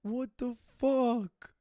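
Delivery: slow, drawn-out disbelief; disbelieving what the fuck. Retold or imitated. Let down at the club, slow stunned disbelief. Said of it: "I was like".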